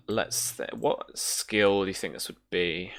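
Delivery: ordinary delivery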